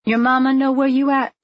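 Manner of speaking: high, sustained challenging question; nosy woman challenging a girl; demanding busybody. A narrator's reading. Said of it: "the woman demanded".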